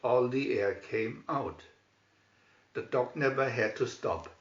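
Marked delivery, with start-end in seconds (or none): none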